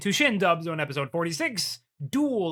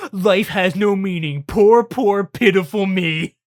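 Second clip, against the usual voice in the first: mock self-pity; 4 semitones higher, 7 dB louder, slower than usual. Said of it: "he goes".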